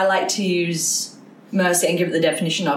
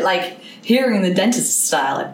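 ordinary talk, nothing marked

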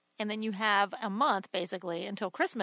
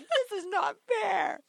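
put-on high, slow childish whine; whiny child; 10 semitones higher, slower than usual. A six-year-old complaining about the gag gift, slow high whine. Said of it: "So I was like".

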